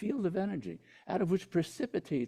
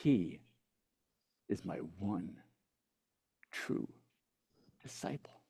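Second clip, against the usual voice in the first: hushed reverence; 7 semitones lower, 9 dB quieter than usual. Retold or imitated. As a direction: let each word land gently. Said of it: "looks at him and goes".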